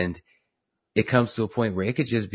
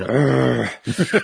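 laughing groan at her hair; disgusted groan; 5 semitones higher, 7 dB louder than usual. Retold or imitated. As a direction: groan like you've seen something awful. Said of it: "I can picture Debbie going".

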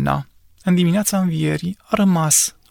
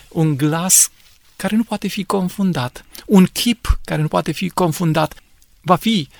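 ordinary talk

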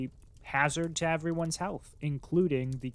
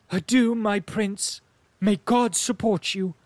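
raised, emphatic voice of a dutiful noblewoman; distressed, resolute princess; 4 semitones higher, 6 dB louder than usual. Acted.

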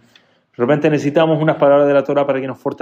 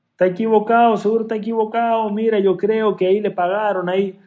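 higher, mocking gossip about the rabbi; scornful coworker; 7 semitones higher than usual.

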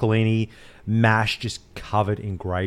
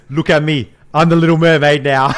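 louder Captain Phillips meme line, laughing through it; half-laugh the pirate's boast, louder; meme quote; 4 semitones higher, 8 dB louder than usual. Retold or imitated. Quoting a meme to his mate, louder, laughing. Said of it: "this is the Captain Phillips meme".

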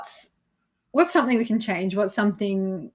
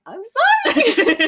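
sheepish apology; 12 semitones higher, 6 dB louder, slower than usual. Retold or imitated. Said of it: "I was just sitting there like".

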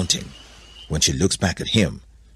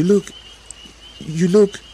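high, loud, unfinished exclamation; stunned Adam; 13 semitones higher, 6 dB louder than usual. A narrator's reading. Adam seeing altered Eve, high stunned faltering. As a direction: break off in high astonishment.

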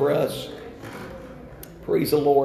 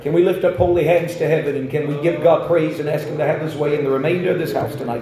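prayer; 4 dB louder than usual. Acted. Opening prayer.

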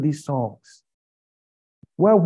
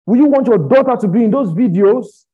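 loud, raised preaching question; heated challenge; 6 semitones higher, 7 dB louder than usual. Real, not acted. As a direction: demand an answer loudly, pitched up. Heated challenge, then repeated harder.

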